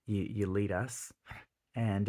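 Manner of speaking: ordinary talk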